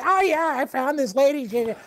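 high, chirpy mom impression; imitating his mom. Son mimicking his mom on the haircut lady, high voice. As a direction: squeak it out like a thrilled mom.

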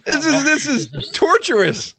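real laughter breaking up high-pitched words; laughing in despair. Real, not acted. Improviser giving up on a torturous scene, laughing. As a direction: whine it high through helpless laughing.

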